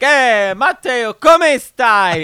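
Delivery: high, loud Italian exclamation; imitated Italian greeting. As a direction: hail a friend in hammed-up Italian. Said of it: "He's like".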